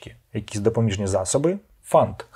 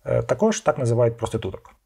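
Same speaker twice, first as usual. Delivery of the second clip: ordinary talk, nothing marked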